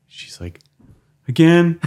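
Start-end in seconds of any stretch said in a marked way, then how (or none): 1.3-1.8 high, loud incredulous again; shocked wife. Retold or imitated. Wife hearing the pain is back, loud shocked disbelief. Wife's alarmed retort, high.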